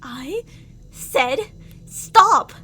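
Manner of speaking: slow, spaced words swelling into a huge shout on stop; building to a scream. Acted. Captor's patience snaps at the captive, rising to a scream.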